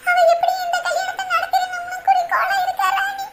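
weeping lament, fading away; sobbing. Acted. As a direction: sob out the grievance to a friend. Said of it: "மூசு மூசுன்னு அழுகுறீங்க".

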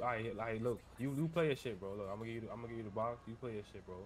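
usual speaking voice

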